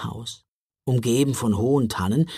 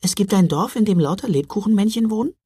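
amazed; 8 semitones higher, faster than usual. Acted. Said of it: "staunte Mila".

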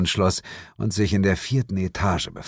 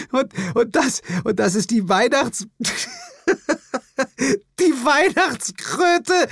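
much higher, words broken by laughter; bursting with laughter. Acted. The woman mocks his frog while bursting out laughing. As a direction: snort out the question while laughing. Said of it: "prustete sie".

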